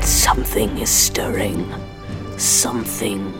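hushed, ominous whisper. Acted. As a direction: breathe it like a secret. Ominous warning in dark tale.

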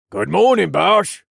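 loud gruff smith greeting. A narrator's reading. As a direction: greet him like a burly blacksmith. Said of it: "Jax said in a gruff voice".

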